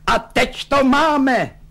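high, drawn-out cry of vindication; slow, high-pitched triumphant outburst; argumentative shout. A narrator's reading. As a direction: shout it as if everyone were arguing with you. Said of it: "vykřikl takovým hlasem, jako by se s ním všichni hádali".

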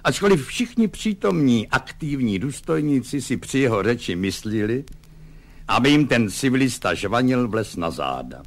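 ordinary delivery

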